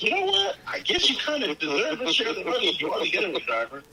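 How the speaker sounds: high-pitched, quick imitation of a movie dad; acted fatherly concession. Film fan voices the dad answering his son, high-pitched mock concession.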